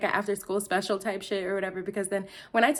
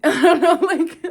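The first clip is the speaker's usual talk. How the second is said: laughing; 9 semitones higher, 11 dB louder, slower than usual. Real, not acted. Laughing at her own story.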